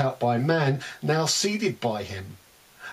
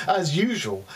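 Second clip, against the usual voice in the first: usual speaking voice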